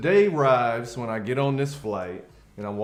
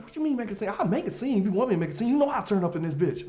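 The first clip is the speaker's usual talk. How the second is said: sassy threat; 9 semitones higher, faster than usual. Retold or imitated. Partner threatens scene.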